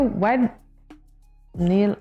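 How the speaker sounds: ordinary delivery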